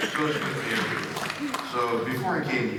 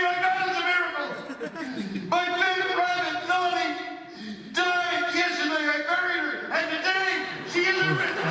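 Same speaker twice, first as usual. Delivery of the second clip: high, loud ecstatic cry; screaming neighbor; 3 dB louder than usual. Retold or imitated. Neighbor finding her rabbit alive, high joyful scream. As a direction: shriek with joy over a miracle. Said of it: "And she's screaming".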